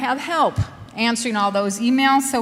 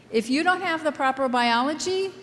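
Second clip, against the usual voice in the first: normal talking voice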